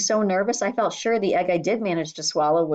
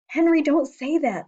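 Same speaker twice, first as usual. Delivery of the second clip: hissed; 9 semitones higher, 4 dB louder than usual. A narrator's reading. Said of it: "I hissed".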